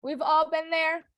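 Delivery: ordinary talk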